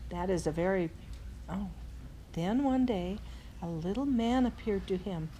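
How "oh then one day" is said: ordinary delivery, nothing marked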